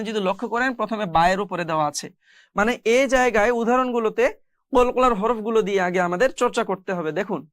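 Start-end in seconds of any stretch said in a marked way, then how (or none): none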